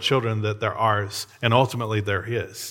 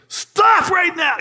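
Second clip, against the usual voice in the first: very high, loud, drawn-out command; retold yell; 6 dB louder, slower than usual. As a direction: re-enact a father yelling to halt his child. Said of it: "if I yelled the way I did".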